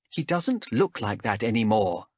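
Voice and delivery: ordinary delivery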